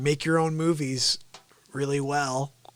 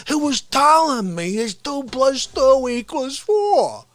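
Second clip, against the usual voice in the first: indignant rant; 6 semitones higher than usual. Acted. Character argues the math.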